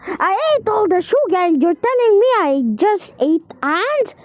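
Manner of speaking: usual speaking voice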